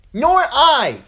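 put-on high, loud boyish yell; shrieked youthful defiance; boy's yell. A narrator's reading. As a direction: yell it out like an eager little boy. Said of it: "yelled the little Derry boy".

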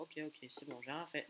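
ordinary talk, nothing marked

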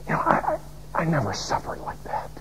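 whispered confession; ashamed whisper. Retold or imitated. Preacher confessing to the martyr that he never suffered, whispered. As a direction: murmur it, ashamed.

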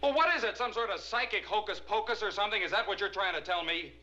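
high-pitched, incredulous outburst; heated, disbelieving questioning; exasperated challenge. Acted. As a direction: demand an answer, loud at first. Husband challenges psychiatrist's implication.

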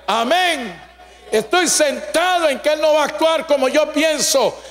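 high, slow, emphatic sermon voice; insistent preacher driving home certainty; forceful preaching. Real, not acted. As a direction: declare it with conviction, stretching the key words.

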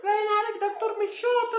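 high put-on woman's voice; falsetto woman voice. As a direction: squeak it like a woman in labor. Man voicing woman in labor.

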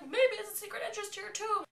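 very high, loud put-on shout; top-of-the-range exaggerated repeat; mock loud relay. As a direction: imitate someone loudly passing on a hint. Clara repeats the prompt, escalating.

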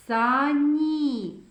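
сані with a long last і; final і drawn out. Shown as a demonstration.

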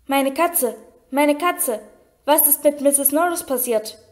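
ordinary delivery, nothing marked

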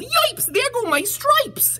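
very high, squeaky cry of dismay; yelping zebra. Acted. Zebra loses his stripes, high yelping voice. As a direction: yelp long and high in alarm. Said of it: "The zebra yelled".

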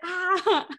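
high, loud laughing "ah"; mock exclamation. Wife recalls interrupting husband's work call, mock exclamation with laughter. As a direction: burst out in a mock cry, laughing. Her retold reaction, laughing.

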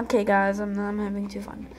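ordinary delivery

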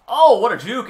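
loud, high burst of excitement at the juke; excited shout. Real, not acted. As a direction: yell it out, thrilled by the move. Big run in the game.

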